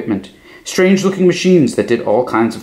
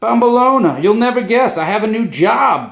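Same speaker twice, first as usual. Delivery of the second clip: high, bright shouting with joy; gleeful high shout; excited shout; 11 semitones higher than usual. A narrator's reading. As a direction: burst in with the happy news. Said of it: "Big Anthony shouted".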